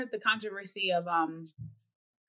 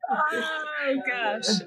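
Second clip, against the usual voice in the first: exasperated outcry; 6 semitones higher than usual. Real, not acted. Cries out at the segment.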